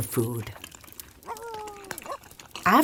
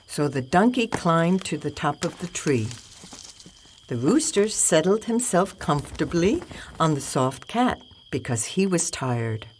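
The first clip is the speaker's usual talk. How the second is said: ordinary delivery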